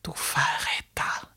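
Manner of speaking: hissed whisper, no voice. A narrator's reading. Narrator hissing at the traitor in the ice. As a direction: spit it out as a hiss. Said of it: "zischte ich".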